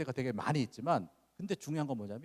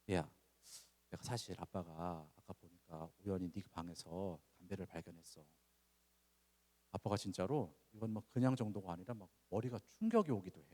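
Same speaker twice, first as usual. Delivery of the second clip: softer, calm fatherly tone; calm gentle talk; 5 semitones lower, 7 dB quieter than usual. Retold or imitated. Father calmly asking son about cigarettes, softly. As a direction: gently invite him to talk. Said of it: "기도 한번 딱 하고요".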